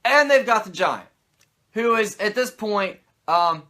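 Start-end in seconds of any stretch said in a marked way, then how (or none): none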